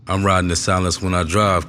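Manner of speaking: ordinary delivery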